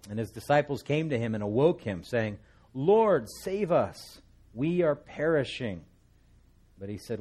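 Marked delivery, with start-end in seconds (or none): 2.7-5.8 high, loud cry of men about to drown; panicked cry for help. Acted. Disciples wake Jesus in the storm, crying out in panic. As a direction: shout it over the storm, desperate.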